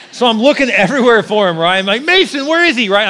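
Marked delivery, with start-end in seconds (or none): 2.0-2.9 panicked yell, much higher. Retold or imitated. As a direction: yell out, searching the store. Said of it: "I'm yelling his name everywhere".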